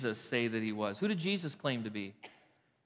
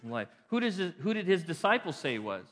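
ordinary delivery

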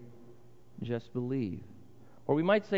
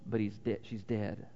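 hushed blunt news; 7 semitones lower, 6 dB quieter than usual. Acted. Messengers say the girl died.

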